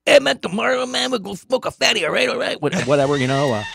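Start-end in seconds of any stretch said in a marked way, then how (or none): 0.1-2.6 high-pitched stoner buddy voice. Shown as a demonstration. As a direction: do a comic stoner impression, pitched way up. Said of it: "like, [name] and [name]".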